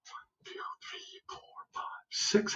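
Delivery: hushed, voiceless counting under the breath; whispered counting. Real, not acted. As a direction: count softly to yourself.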